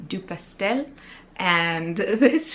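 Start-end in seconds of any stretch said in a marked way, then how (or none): 0.1-0.9 name said with a put-on French accent; a quieter French accent on the shop name. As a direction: give it your best French accent, a touch hammed-up. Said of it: "put on my best French accent".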